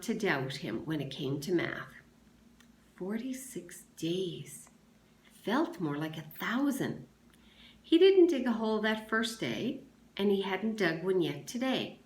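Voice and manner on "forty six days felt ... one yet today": ordinary delivery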